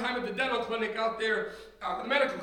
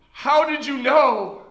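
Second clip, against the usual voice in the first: loud, slow, choking question; asking through sudden tears; choked-up cry; 8 dB louder, slower than usual. Retold or imitated. Young sailor asking his preacher, choked up and loud.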